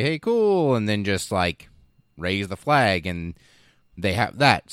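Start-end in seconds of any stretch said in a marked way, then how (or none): 0.0-0.7 chirpy high, offhand; mock-casual workers. Scrap workers casually claiming the island, high and breezy. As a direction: say it like planting a flag is nothing. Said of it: "go on over there and say".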